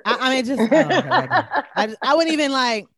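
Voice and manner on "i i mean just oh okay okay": talking through laughter; laughing. Real, not acted. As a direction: laugh the words out.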